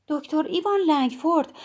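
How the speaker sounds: as if reading an impossible name off the screen; a hint of dazed wonder; stunned disbelief. A narrator's reading.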